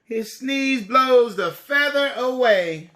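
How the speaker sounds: lively, bright storybook reading; reading to children. A narrator's reading. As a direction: read it big for little kids. Storybook narration.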